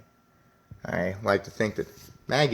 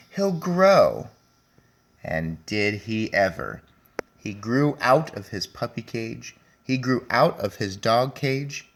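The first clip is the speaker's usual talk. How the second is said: lively, a bit louder storybook reading; reading to children; 2 semitones higher, 6 dB louder than usual. A narrator's reading. Teacher reading Henry and Mudge to her class, lively storybook voice. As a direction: read it out brightly for the class. Teacher reads picture book aloud.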